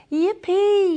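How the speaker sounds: high, loud yippee; a big high whoop of joy; yell of joy. Acted. Sinead whooping with joy while dancing, high and loud. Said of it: "she yelled".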